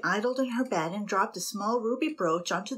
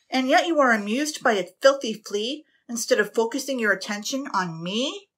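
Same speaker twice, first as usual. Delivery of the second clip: louder, higher wounded indignation; captain demanding her attention; aggrieved captain; 5 semitones higher, 6 dB louder than usual. A narrator's reading. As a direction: guilt her for the crow.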